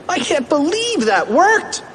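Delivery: high, thrilled exclamation; excited cartoon cry; amazed cry. A narrator's reading. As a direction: call it out high in disbelief. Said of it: "cried Cheeseball".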